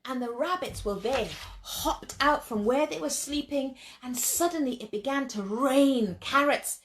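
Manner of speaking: plain reading voice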